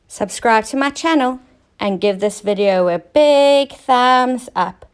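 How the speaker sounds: ordinary delivery, nothing marked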